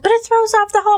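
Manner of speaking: put-on high whiny kid voice; daughter's whiny voice. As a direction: pitch way up like an upset girl. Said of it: "She said".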